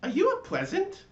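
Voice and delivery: high, loud, curious pine tree voice; sleepy pine tree. A narrator's reading. The pine tree questions the latke, high curious voice. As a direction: wake up and ask, puzzled and high.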